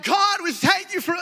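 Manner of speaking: high, loud, slow churchy pray-er; mock fervent prayer. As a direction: ham up a pious prayer. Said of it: "You're like".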